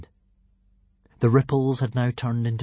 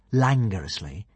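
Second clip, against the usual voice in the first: ordinary delivery, nothing marked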